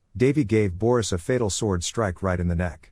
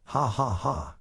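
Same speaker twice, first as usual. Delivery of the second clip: soft, mocking villain chuckle; gloating ha ha ha; evil laugh; 4 semitones higher, 5 dB quieter, slower than usual. A narrator's reading. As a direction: laugh at them under your breath. Villain gloats over his threat.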